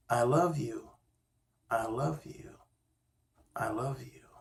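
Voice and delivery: put-on the cave echoing love back to the boy; fading echo. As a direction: let it fade away like a cave echo. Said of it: "he heard back".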